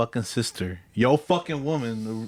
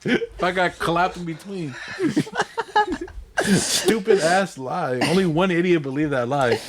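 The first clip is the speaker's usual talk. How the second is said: 1 laughing through words; 8 semitones higher than usual. Real, not acted. Laughing at own lie.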